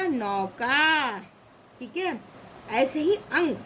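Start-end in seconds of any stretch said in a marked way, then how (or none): 0.0-1.2 नौका slowly as nau, kaa; drawn-out syllables. Shown as a demonstration. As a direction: stretch both halves of नौका. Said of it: "का में आ की मात्रा".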